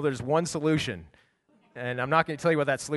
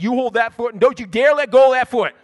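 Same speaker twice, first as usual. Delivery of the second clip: louder and higher, fast orders to his son; urgent loud command; 7 semitones higher, 11 dB louder, faster than usual. Retold or imitated. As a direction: bark the plan at Caleb. Urgent orders holding cat.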